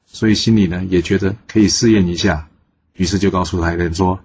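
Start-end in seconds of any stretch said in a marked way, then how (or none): none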